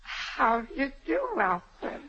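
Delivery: thumb in mouth. Acted. Old mother greeting Alfred, thumb in mouth, mumbled. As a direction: greet him with your thumb still in your mouth. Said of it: "Take your thumb out of your mouth".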